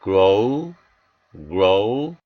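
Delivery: "grow, grow" with a long gliding oh-oo; long O sound. Shown as a demonstration. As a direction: draw out the final vowel of "grow, grow" as 오우. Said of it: "장모음 오우 소리가 납니다". ("grow, grow" 끝을 장모음 '오우'로 길게)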